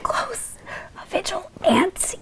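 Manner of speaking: slow breathy whisper; drawn-out whispered punchline; stage whisper. Acted. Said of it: "This is my stage whisper".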